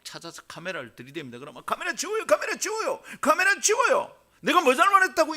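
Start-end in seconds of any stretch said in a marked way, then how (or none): 1.7-5.3 very high, loud repeated demand; acted panicked protest. Person ambushed by a TV camera, acted panicked protest. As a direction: shriek at the intruding camera, building louder.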